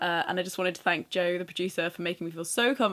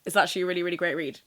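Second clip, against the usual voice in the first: ordinary delivery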